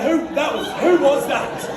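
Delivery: high, loud feigned innocence; hammed-up who was that; mock innocent. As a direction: pretend you're blaming someone else. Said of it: "I have to join it looking around".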